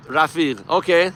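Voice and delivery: ordinary talk, nothing marked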